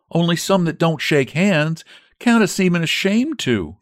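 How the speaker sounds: maid's voice. Acted. Narrator voices Elpha the maid.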